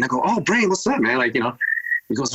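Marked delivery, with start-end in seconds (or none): 0.2-1.2 much higher-pitched, friendly phone hello; retold upbeat greeting. DJ answering a drummer's surprise call, upbeat greeting. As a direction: greet an acquaintance with a sudden lift in pitch.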